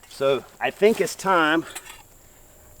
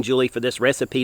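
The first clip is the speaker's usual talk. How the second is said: normal talking voice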